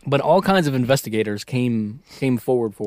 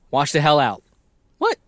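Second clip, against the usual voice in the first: ordinary talk, nothing marked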